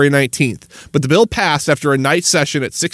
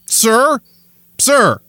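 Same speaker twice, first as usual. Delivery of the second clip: sarcastic polite address; 6 semitones higher than usual. Acted.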